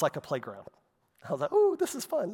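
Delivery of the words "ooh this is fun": mock delight. Mock carefree 2020 self.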